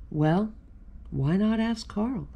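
usual speaking voice

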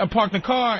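ordinary talk

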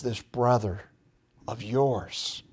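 a father softly reminding his son who this is; quiet, compassionate plea. Retold or imitated. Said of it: "He says it with compassion".

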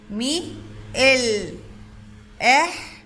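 ملح split slowly into مي، ال، إح; syllable by syllable. Shown as a demonstration. Teacher segmenting ملح for the class, sound by sound. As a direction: hold each sound of ملح apart. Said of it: "تقطيعها".

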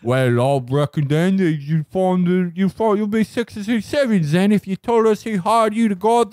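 high, hammed-up British accent; mock British. Hideous British man explains to the heroes, in mock British. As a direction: play a silly British villain in hammed-up British. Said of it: "he's like".

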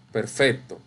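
ordinary delivery

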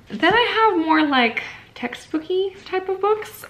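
gushing over the next stack, voice leaping up; excited. Real, not acted. Vlogger introducing her textbook pile, excitedly high and loud.

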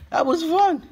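high, delighted squeal of fun; real giddy excitement after the challenge; excited squeal. Real, not acted. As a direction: burst out high with glee. Thrilled after popping balloons.

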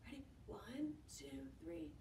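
hushed whisper so as not to scare him. Shown as a demonstration. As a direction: whisper the count softly. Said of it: "let's try it quietly".

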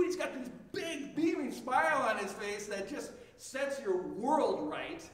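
ordinary delivery

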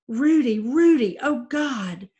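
high, loud anguished crying out; desperate cry. Acted. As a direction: plead over her husband. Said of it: "Senor Castillo cried".

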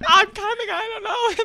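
high, very loud mock panic; retold panicked yell. Running down the train with the card, high panicked yell. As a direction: holler it frantically on the run.

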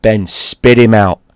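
loud, slow dog command; firm command. Retold or imitated. Dad orders the dog off.

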